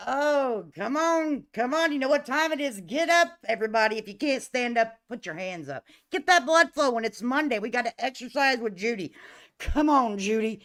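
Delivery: loud, high exercise-leader rallying; playful workout hollering, building to the last command; hype-up call. Pretend. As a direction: rouse the whole room to get moving. Rousing everybody for exercise with Judy.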